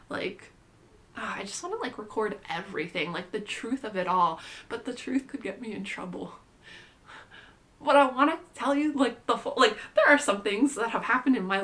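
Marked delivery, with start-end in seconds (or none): 4.7-6.3 hushed secret whisper. Real, not acted. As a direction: whisper it like a confession. Confiding a risky secret.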